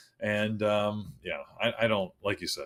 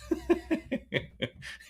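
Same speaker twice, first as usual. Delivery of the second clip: breathy, high-pitched chuckle; real amused giggle; laughing. Real, not acted. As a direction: laugh at being called dumb. Amused at being called dumb.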